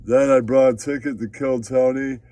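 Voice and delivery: ordinary delivery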